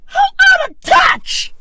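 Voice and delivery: mock wailing. Mocking tears over out-of-touch rich, hammed-up crying wail.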